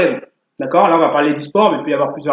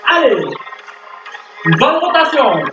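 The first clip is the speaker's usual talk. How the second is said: barking gym coach; 7 semitones higher, slower than usual. Acted. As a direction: pump the class up like a fitness coach. Leads workout over music.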